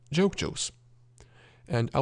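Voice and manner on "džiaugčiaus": džiaugčiaus stopping on the s; shortened ending. Shown as a demonstration. Teacher repeating the short form, džiaugčiaus without final i. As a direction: leave off the last i in džiaugčiaus. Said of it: "sometimes we can shorten".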